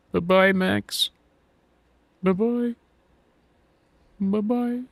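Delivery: put-on high, childish sing-song farewell; squeaky mock goodbye as they drive off; mock bye-bye. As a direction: wave them off in a high little voice. Mocking the sad tag team leaving.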